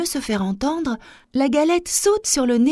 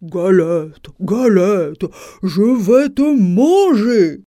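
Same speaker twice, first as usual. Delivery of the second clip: loud, slow, heavy bear threat; booming storybook bear; growling bear, big voice; 3 semitones lower, 7 dB louder, much slower than usual. A narrator's reading. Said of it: "grogne l'ours de sa grosse voix".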